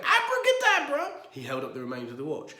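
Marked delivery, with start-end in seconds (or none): 0.0-1.3 much higher, much louder furious shout; yelling beside himself with rage. A narrator's reading. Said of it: "shouted Mister Curry beside himself".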